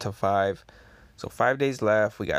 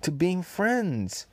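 Queen, puzzled; 6 semitones higher than usual. Acted. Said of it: "Queen says".

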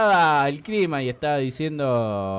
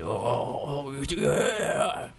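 breathy demonic metal growl; demonic whisper; 5 dB quieter, much slower than usual. Retold or imitated. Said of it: "tienen esa segunda voz demoníaca".

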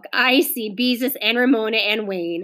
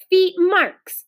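higher-pitched stubborn little sister; stubborn, raised-pitch character voice; 9 semitones higher, 5 dB louder than usual. A narrator's reading. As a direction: insist on it stubbornly. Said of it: "said Ramona stubbornly".

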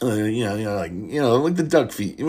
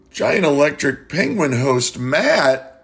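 slow, grand announcer reveal; mock announcer; 3 semitones higher, 4 dB louder, slower than usual. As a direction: announce the winner like an awards host. Mock award announcement with music.

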